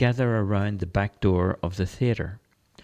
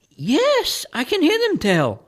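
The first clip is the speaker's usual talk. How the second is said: much higher, louder, in awe; awed priest; 6 dB louder than usual. Acted. Priest with ear to the wall, awed and high. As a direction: gasp it out, suddenly hearing them. Father Saul hears the echoes.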